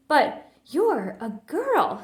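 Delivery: put-on can't believe it's a girl; shocked big brother; stunned. A narrator's reading.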